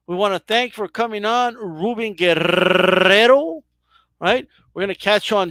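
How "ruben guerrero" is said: drawn-out "Guerrero" with a long rolled r; slow, showy announcement of "Guerrero"; announcer-style name. Pretend. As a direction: roll the r in "Guerrero" like a ring announcer. Host signs off his guest.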